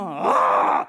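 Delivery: a long high cry of pain at the sky; anguished yell. Retold or imitated. Pastor retells crying out at God by the road, high scream. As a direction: let out a raw, high scream. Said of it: "గట్టి గట్టిగా అరుస్తున్నా".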